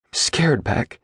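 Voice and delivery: high, trembling and frightened; quavering voice. A narrator's reading. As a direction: let the voice shake with fear. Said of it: "Caroline says in a quavering voice".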